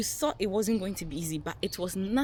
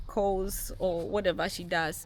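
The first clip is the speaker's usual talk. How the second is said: ordinary talk, nothing marked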